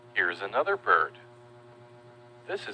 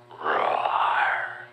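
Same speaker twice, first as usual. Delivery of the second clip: mock lion roar; 5 semitones lower than usual. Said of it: "the lion goes".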